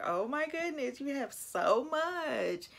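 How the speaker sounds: mock amazed, drawing out the words; making a big deal. Karen fussing at Miles over all his shoes, mock amazement. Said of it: "made a huge deal about it".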